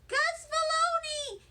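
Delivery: high, excited holler across the room. A narrator's reading. First grader hollering to the janitor, high and excited. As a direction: shout his name like you're thrilled. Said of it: "I hollered out".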